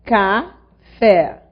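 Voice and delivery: Café split into ca and fé with a clear pause; Café in two separate beats, ca... fé; syllable by syllable. Shown as a demonstration. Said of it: "vou dividir as sílabas da palavra".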